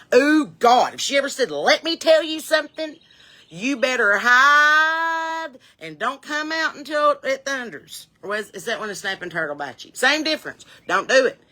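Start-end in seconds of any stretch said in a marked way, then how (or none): 1.5-8.1 high, drawn-out mock warning; upset mother. Mother threatening her child, mock upset and high. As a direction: imitate Mom stretching out her threat. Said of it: "my mother would get upset".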